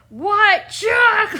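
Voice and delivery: high, loud mock yelling; cartoon shout. Streamer reenacting the chocolate scene, loud and high. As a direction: yell it like the frantic fish.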